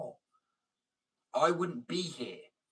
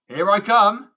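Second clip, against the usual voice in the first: higher and much louder, crowing; triumphant shout; 9 semitones higher, 16 dB louder, slower than usual. Real, not acted. Boasting to American critics, triumphant shout. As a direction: shout it like a conquering arrival.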